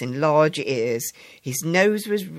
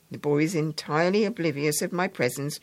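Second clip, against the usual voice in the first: ordinary delivery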